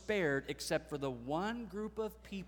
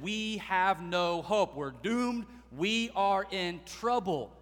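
slow, louder pleading cry of the doomed; desperate plea; 4 semitones higher, 7 dB louder, slower than usual. Retold or imitated. Gibeonites begging Israel for their lives, slow desperate plea. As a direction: beg as if all hope is gone. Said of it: "the one group of people who said".

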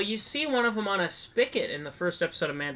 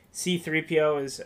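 normal talking voice

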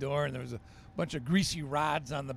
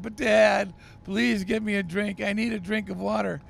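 put-on higher, louder child calling out; boy calls down to his dad, loud and pleading; loud pleading kid; 5 semitones higher, 6 dB louder than usual. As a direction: shout the plea down to Dad.

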